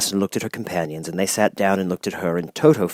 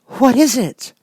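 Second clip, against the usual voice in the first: surprised; 4 dB louder, slower than usual. A narrator's reading. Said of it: "in surprise".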